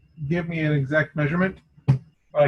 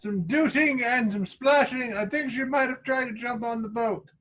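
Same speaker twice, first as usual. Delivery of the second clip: high-pitched adventurer's report; raised character voice, relaying news; in-character lookout reporting back; 8 semitones higher than usual. Acted. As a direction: report what you heard, in character. Answers in character as Gerald.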